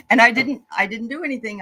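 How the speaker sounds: ordinary delivery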